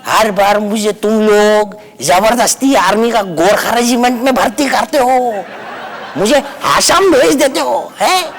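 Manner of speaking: mock Nepali watchman complaint. Watchman grumbling to the writers about his role. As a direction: complain like a put-upon Gorkha watchman.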